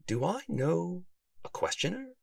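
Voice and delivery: high, soft questioning lilt; puzzled self-questioning. A narrator's reading. Detective asks himself about the sender, puzzled. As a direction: wonder aloud, pitching the question upward. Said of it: "Sylvester asked himself".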